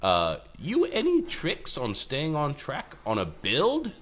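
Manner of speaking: slow, high mock British reading of the question; British accent. Host rereads listener question in mock British accent. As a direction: ask it like a cheeky Brit. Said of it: "I'll do this in a British accent".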